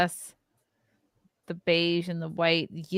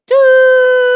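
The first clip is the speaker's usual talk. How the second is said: loud high whistle toot; shrill toot; 14 dB louder than usual. Pretend. Belly button steam whistle.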